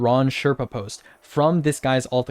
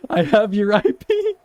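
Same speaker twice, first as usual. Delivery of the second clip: much higher, slow mock threat; mocking squeaky voice; 10 semitones higher than usual.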